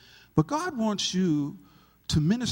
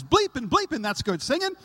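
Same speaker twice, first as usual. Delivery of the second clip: louder, higher burst from the back; yelled praise; 8 semitones higher, 3 dB louder than usual. Retold or imitated. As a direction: call out from across the room. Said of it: "the guy in the back yells".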